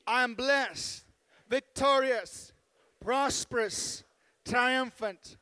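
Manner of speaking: loud, emphatic declaration in short phrases; congregational declaration. Acted. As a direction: proclaim it boldly, pausing for the congregation to repeat.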